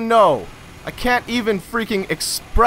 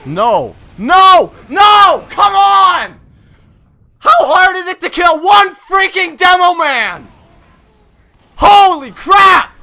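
higher, louder angry yells; furious shouting; 5 semitones higher, 7 dB louder, slower than usual. Real, not acted. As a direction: scream it at the enemy. Raging at the demoman.

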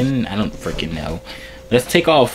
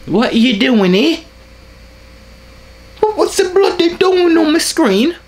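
loud, much higher put-on British outburst; angry British yell; 6 dB louder than usual. Prankster yells at a stranger on screen in a fake British accent. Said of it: "I'm only good at being angry British".

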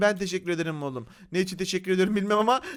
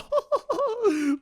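high-pitched giggling laugh; amused high cackle; real laugh; 14 semitones higher than usual. Real, not acted. Laughing at house in hand.

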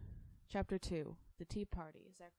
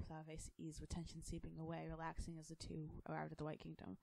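ordinary delivery

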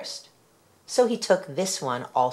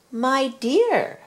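higher and louder, shocked; old woman sees the cats coming; alarmed cry; 9 semitones higher, 4 dB louder than usual. A narrator's reading. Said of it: "she cried".

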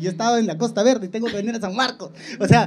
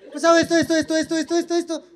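mocking nagging; 8 semitones higher than usual. Mocking her complaints, repeated.

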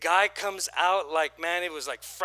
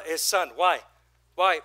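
ordinary talk, nothing marked

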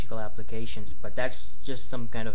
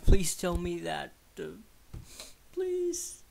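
higher, softer, partly whispered begging of an inner demon; evil inner voice pleading; 5 semitones higher, 12 dB quieter than usual. Retold or imitated. Mocking his own evil side begging there is no God, in a high whispered plea. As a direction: plead as the evil part of yourself that dreads a creator. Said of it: "something really evil in me tries to come out".

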